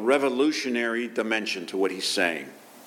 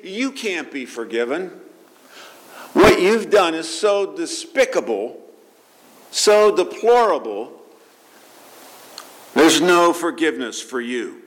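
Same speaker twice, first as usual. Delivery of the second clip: accusing inner voice, louder and pressing; voice of condemnation; 6 semitones higher, 3 dB louder than usual. Retold or imitated. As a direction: scold yourself without mercy. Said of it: "Maybe it's saying".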